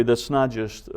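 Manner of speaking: ordinary talk, nothing marked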